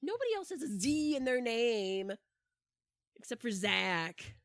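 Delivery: hammed-up annoyance; mock frustration. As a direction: vent like a fed-up kid. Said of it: "I'm like".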